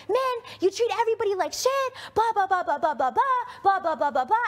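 high, loud whiny mock of his rant; mocking imitation. Mocking what Kyle said to her in the fight, high whiny parody. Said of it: "And you're like".

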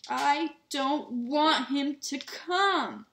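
high, loud, very drawn-out wail; bratty yell. A narrator's reading. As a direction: yell it like a spoilt child faking tears. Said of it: "yelled Dudley".

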